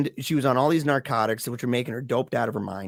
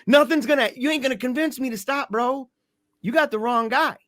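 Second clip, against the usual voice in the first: high-pitched, forceful retold refusal; acted defiance; 11 semitones higher, 3 dB louder than usual. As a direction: act out refusing to budge, voice pitched right up.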